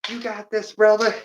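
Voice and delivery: high cheering mom voice. Retold or imitated. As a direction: cheer him on like a proud mom. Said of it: "She'd be out here".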